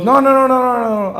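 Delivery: high, loud rush of no's; panicked to reassure her fast; frantic denial. Retold or imitated. Caught out by his date, he blurts no over and over. Boyfriend hastily denying not liking her.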